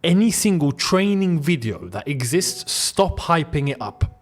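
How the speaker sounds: usual speaking voice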